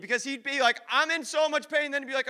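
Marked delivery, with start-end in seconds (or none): 0.9-1.9 imitated wail, higher but only mildly louder; mock high cry of pain; screaming. Preacher imitates brother complaining all night, mock screaming. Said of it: "him screaming".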